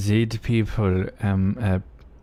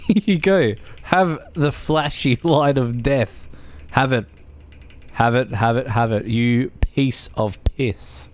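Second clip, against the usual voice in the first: put-on Aussie accent; 2 semitones higher than usual. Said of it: "you with bad Aussie accents".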